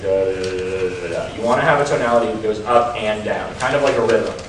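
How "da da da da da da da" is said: droning da da da, lower and trailing off softer; mock a dull monotone speaker; flat droning syllables. Shown as a demonstration. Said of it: "speak very monotonely".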